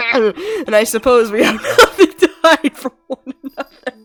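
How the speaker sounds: line broken by a hammed-up laugh; laughing villain line. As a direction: chuckle through the words smugly. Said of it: "That's what I'm making us laugh as".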